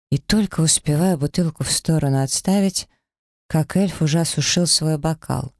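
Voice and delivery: usual speaking voice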